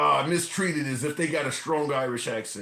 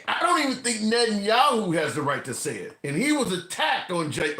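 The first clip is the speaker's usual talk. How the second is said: ordinary talk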